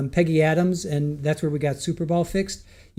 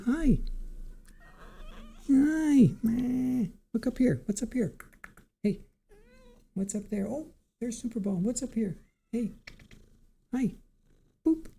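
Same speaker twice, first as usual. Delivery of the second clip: high, much softer pet coo; 7 semitones higher, 7 dB quieter than usual. Real, not acted. Owner coaxing Superball, soft high baby talk. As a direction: coax the cat up gently.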